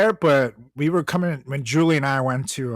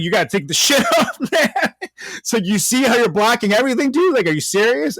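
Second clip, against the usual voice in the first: louder, higher, fed-up telling off; annoyed demand; 8 semitones higher, 4 dB louder than usual. Retold or imitated. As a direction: scold him loudly, fed up.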